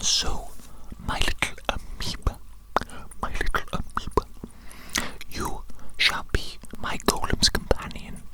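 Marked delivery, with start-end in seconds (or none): none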